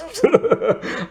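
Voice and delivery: high, short real chuckle; amused laugh. Real, not acted. Reviewer amused at Canadians sounding British, amused laugh. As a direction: crack up briefly, pitched high.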